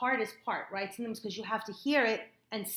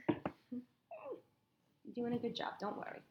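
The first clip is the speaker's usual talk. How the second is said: gently slow it down to comfort her; reassuring; 3 semitones higher, 7 dB quieter, much slower than usual. Real, not acted. Tutor softly reassuring her young reader.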